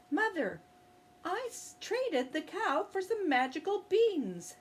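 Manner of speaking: put-on high, eager boy's voice; boy telling his mother the exciting news; Jack's voice. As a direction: play young Jack, pitched well up.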